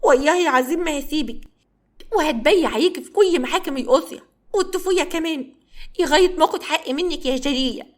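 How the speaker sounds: high, whining wail of complaint; mock sobbing rant, pitched high; crying loudly. A narrator's reading. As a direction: bawl it out like a wronged child. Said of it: "بيعيط وبيقول بصوت عالي".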